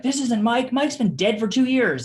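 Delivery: high, loud imitation of an angry caller; mock the furious son's outburst; retold angry man. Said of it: "starts cussing at me".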